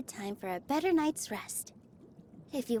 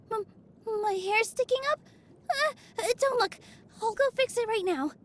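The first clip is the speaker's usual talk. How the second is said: higher, hurried embarrassed stammer; flustered; 7 semitones higher than usual. Acted. Dancer caught with bed hair by a friend, flustered. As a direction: caught off guard, scramble to hide it.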